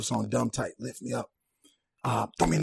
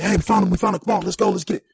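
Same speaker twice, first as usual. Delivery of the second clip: higher, eager call over the radio; excited radio chatter; 8 semitones higher, 7 dB louder, faster than usual. Retold or imitated. Cops on the radio cheering they found him, excited call. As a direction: sound like cops who just found him.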